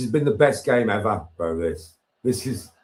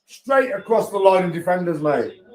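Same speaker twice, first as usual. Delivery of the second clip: ordinary delivery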